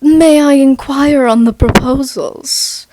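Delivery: hammed-up formal inquiry, drawn out; mock old-timey. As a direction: ham up a verbose period line. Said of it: "People might instead phrase that as".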